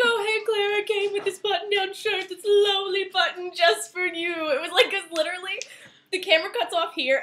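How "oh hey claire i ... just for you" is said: high, louder hammed-up flirt; mock sultry Eli. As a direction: ham up a smooth flirt. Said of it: "He was like".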